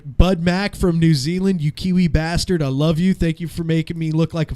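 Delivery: loud, hearty shout-out; cheering a listener by name. Real, not acted. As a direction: call it out to the fan on air. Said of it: "give a big shout out".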